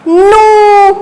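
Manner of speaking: नू with a long, held "oo"; pronunciation demo. Shown as a demonstration. Teacher showing the pronunciation, नू drawn out. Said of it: "इसका उच्चारण होगा".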